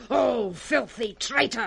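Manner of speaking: ordinary talk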